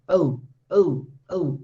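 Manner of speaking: "oh" glided from o into oo; diphthong demonstration. Shown as a demonstration. Teacher isolating the diphthong, carefully glided "oh". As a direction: sound out "oh" as a separate two-part glide. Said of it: "Like this is the pronunciation".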